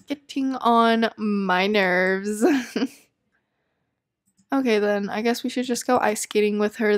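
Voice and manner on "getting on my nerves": very slow, each word stretched out; slow drawn out. Shown as a demonstration. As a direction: drag it out slowly in exasperation.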